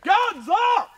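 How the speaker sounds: shouted call. Real, not acted. Team celebratory call-out.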